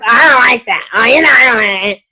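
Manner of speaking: imitated grumbling, words run together; mock a complainer mumbling through objections; negative customer. Guest who dislikes the product, grumbling in imitation. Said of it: "we also don't want those negative ones".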